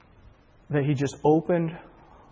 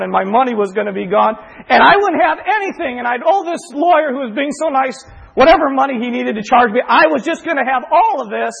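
higher and louder, laughing at the bad news; excited disbelief; 7 semitones higher, 6 dB louder than usual. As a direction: rattle off the disasters with joyful glee. Joyfully retelling the lawsuit.

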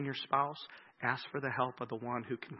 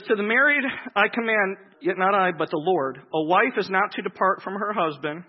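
loud, higher preaching read of the verse; scripture reading; 4 semitones higher, 11 dB louder than usual. Acted. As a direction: proclaim the passage from the pulpit. Reads Scripture aloud.

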